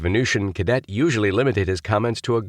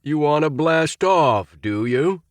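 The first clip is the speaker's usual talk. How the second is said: bawled; 9 semitones higher than usual. A narrator's reading. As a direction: bawl the taunt slowly. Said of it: "bawled Astro".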